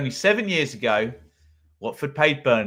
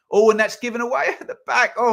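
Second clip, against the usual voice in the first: excited outburst; 10 semitones higher, 5 dB louder, faster than usual. Real, not acted. Shock at a defensive giveaway.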